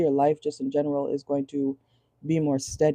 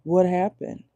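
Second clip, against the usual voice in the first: mock innocent surprise; 3 semitones higher, slower than usual. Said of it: "and then being like".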